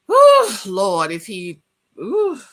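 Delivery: loud exasperated whew, Lord; sudden loud sigh of exasperation; emotional whew. Real, not acted. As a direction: blow out a loud overwhelmed breath. Overcome talking about death.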